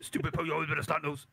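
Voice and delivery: one breathless run-on mumble; fast, words run together. Retold or imitated. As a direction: slur the insult into a single word. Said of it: "no, like, word separation".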